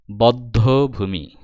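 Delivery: বদ্ধভূমি drawn out very slowly; slow teacher's sounding-out of বদ্ধভূমি; pronunciation demo. Shown as a demonstration. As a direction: break বদ্ধভূমি into bod-dho-bhumi. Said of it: "দেখো উচ্চারণ".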